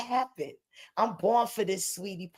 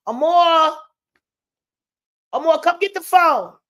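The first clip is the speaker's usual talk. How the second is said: higher, louder call; 5 semitones higher, 7 dB louder than usual. Real, not acted. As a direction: call her to come take the phone. Calling child to phone.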